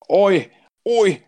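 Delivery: mock British yobbish call. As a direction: shout "oi, oi" like a British lad. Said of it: "Gotta have some sort of, uh, British accent".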